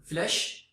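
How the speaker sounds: 'flash' said with an E, like flesh; E ile okunuş. Shown as a demonstration. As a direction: say 'flash' with a clear 'eh' vowel. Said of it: "okunuşu E, A, A gibi". ('flash' kelimesi 'fleş' gibi, E sesiyle)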